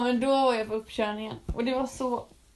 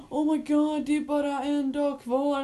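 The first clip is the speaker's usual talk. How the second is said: mock excited; 3 semitones higher, slower than usual. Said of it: "jag ville inte att alla skulle bara".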